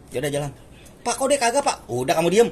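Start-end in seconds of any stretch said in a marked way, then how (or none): 1.0-1.8 high-pitched, louder protest to an officer; mock protest. Student asks officer why the other is spared, protesting. 1.9-2.5 louder, higher imitation of an officer's order; mock scolding. Officer hushes a student during a raid, scolding.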